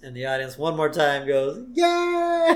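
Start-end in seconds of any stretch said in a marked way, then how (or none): 1.7-2.5 high, drawn-out mock cheer; long, high "yay" of a crowd. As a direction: imitate an audience cheering. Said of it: "And they all cheer".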